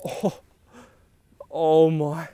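ordinary delivery

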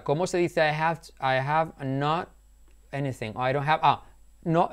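ordinary talk, nothing marked

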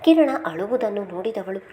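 plain reading voice

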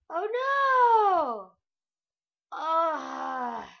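high loud startled cry; shocked outcry. Real, not acted. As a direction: cry out as danger hits. Zombie attack surprise.

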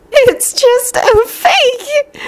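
very high, drawn-out tearful voice; sobbing plea. A narrator's reading. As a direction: plead slowly through acted sobs. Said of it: "they heard Hermione sob".

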